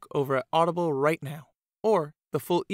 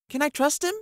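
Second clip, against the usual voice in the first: put-on much higher, louder young girl; girl's question; 13 semitones higher, 6 dB louder than usual. A narrator's reading. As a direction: ask it like a wary child. Fee asks Jack anxiously.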